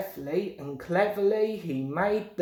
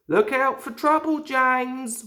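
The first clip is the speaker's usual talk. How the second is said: warning driver; 6 semitones higher, 3 dB louder than usual. A narrator's reading. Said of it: "warned his driver".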